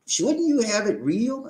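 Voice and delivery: ordinary talk, nothing marked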